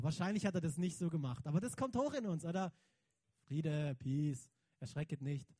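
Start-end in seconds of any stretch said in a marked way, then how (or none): 3.4-5.5 gentle calming voice. Acted. Jesus calms frightened disciples.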